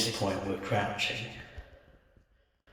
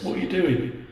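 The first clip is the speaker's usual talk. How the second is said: startled voice; 10 semitones higher, 6 dB louder than usual. Acted. Leon's sudden voice in dark.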